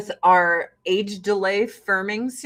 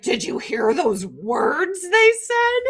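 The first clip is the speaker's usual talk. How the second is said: slow, dopey mock voice of the left; 7 semitones higher, slower than usual. Mocking the left's reaction, slow and dopey. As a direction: drawl it out like a clueless leftist. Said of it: "the left's like".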